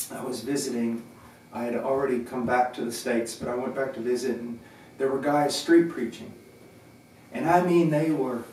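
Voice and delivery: ordinary talk, nothing marked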